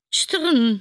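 high, loud clanking noise; metallic rattle. Acted. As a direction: make it clatter like rattling metal. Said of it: "csörrent megint valami". (magas, hangos csörrenés)

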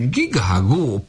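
high, anxious goose honk; lightly honked goose call; worried goose honk. A narrator's reading. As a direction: honk with worry. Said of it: "aggodalmasan gágogott".

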